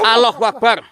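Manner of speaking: high, loud exclamation of fright; real startled cry. Real, not acted. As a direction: cry out in sudden shock. Startled by a sighting. (seruan kaget ketakutan)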